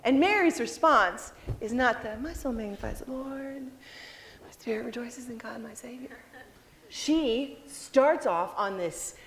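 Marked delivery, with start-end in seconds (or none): 2.2-6.2 mock reverent hush. The hushed reverent version she rejects.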